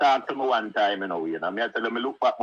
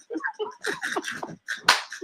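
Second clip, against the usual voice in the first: high squealing laugh; 3 dB quieter than usual. Real, not acted. Laughing at the memory of the puppy. Laughing at his own story.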